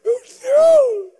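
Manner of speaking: high, loud laughing cry; laughing shout. Real, not acted. The comedian reliving the shock, a high laughing shout. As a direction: burst out half laughing, half shrieking. Sensing the girl beside him.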